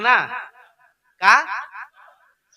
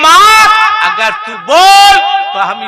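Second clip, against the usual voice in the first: high, loud shout to his mother; shouting son; 14 dB louder than usual. Acted. Son shouting to his mother, high and loud. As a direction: yell it out, pleading with mother.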